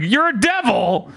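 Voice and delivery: high-pitched mock outrage; flustered bat, pitched well up; panicked bat. Bat accusing Denji, high mock panic. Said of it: "and the bat's just like".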